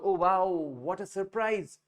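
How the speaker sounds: drawn-out, wide-eyed amazement; surprise. Shown as a demonstration. Teacher showing students how to express surprise, amazed. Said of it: "हैरानी तो दिखाएंगे ना".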